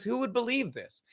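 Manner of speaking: hushed, high mimicry of unbothered amusement; calm mock giggler. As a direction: quote him lightly and quietly, as if amused. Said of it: "He just calmly giggles and says".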